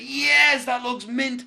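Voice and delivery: high, loud, delighted outburst; buzzing. Retold or imitated. Injured man thrilled watching his stunt back on the phone, buzzing. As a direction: crow it out, buzzing with excitement. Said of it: "I was buzzing with what it was".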